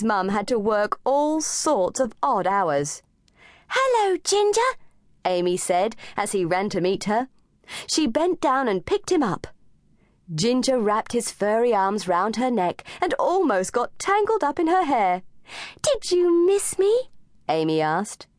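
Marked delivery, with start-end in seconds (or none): 3.7-4.7 put-on high, bright little girl greeting; a child calling to her cat; young girl voice. A narrator's reading. 15.8-16.9 put-on high little girl asking; a child teasing her pet; young girl voice. A narrator's reading. Amy asks her cat.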